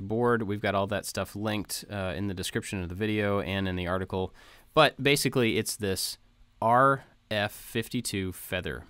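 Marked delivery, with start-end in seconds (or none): none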